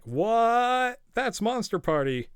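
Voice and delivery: high, loud disbelieving "What?"; mock the brother's stunned disbelief; incredulous. Brother texting back, stunned he beat it, incredulous. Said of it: "He seems incredulous".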